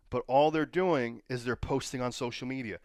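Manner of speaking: normal talking voice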